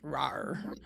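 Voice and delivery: breathy whispered rawr; whispered monster growl. Pretend. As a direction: whisper a teasing little rawr. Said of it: "Please say rawr to Ari".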